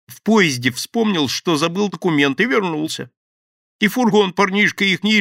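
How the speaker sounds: high, loud anxious babble; frantic explaining. Acted. Thief explains desperately to the gang. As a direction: rush to explain, scared.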